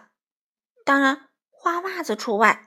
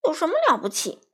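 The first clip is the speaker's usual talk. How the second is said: fast, higher huffy scoff; indignant, hurried jibe; angry; 6 semitones higher, faster than usual. A narrator's reading. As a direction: scoff crossly, quick and pitched up. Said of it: "花袜子生气地说".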